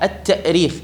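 "التأريخ" with the hamza kept; still hamza sounded. Shown as a demonstration. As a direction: break "التأريخ" with a glottal stop. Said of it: "والهمزة ساكنة". (نطق «التأريخ» بتحقيق الهمزة)

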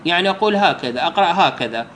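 ordinary delivery

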